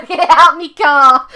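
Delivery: hammed-up yell of get out me car; mock shout. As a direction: bellow it at the driver like a cartoon road-rager. Repeats mum's line, louder.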